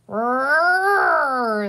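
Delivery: long, loud, rising engine roar; playful rocket roar. A narrator's reading. Boy zooms his toy tractor as a rocket, roaring. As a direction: roar like a child's pretend rocket. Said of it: "said Peter, zooming his tractor through the air".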